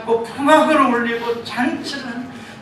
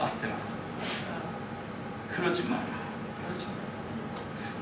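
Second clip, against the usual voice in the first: tender father; 2 semitones lower, 14 dB quieter, slower than usual. Acted. 아버지가 큰아들 달램.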